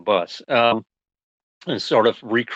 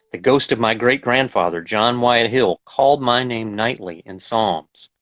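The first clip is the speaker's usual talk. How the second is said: measured, deliberate poetry reading; poem reading; 3 dB louder than usual. Acted. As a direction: read it like a poem, letting each image land. Reads a poem aloud.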